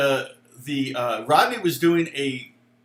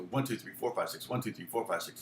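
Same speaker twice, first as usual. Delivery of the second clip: soft, low counting under the breath; counting out rhythm; 8 semitones lower, 12 dB quieter than usual. Shown as a demonstration. Guitar teacher working out how to count the lick, soft rhythmic count. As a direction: count the beat quietly and evenly. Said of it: "You could count it that way".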